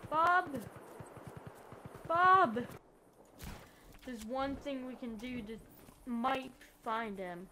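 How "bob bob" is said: loud, high call across the map. Real, not acted. Player calling out for his goat Bob, loud and high. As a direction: shout the name to find him.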